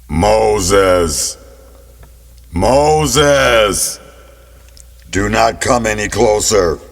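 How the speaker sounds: magically amplified voice of God; loud booming voice. Acted. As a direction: boom it out, low, with echo. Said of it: "a loud and booming voice".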